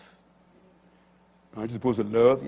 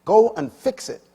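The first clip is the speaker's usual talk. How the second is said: sharp, higher, louder order; retold shout; 7 semitones higher, 6 dB louder than usual. Chaplain ordering the young director, a mild retold shout. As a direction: snap the boss's command. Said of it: "And he shouted at me".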